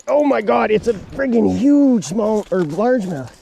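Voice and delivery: amazed. Real, not acted. Seeing the huge fish.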